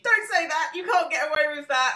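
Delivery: high, loud indignant objection; acted protest. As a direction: protest as if scolding a politician.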